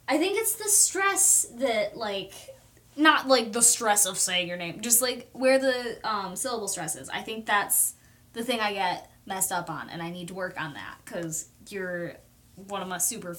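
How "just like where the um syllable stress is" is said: ordinary talk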